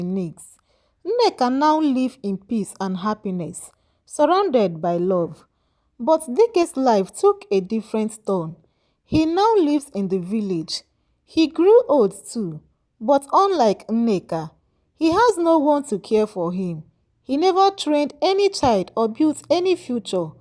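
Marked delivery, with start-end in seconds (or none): none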